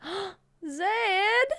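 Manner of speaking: gasp, then a high excited greeting; light up at seeing a friend; gasping happy hello; delighted surprise. Real, not acted.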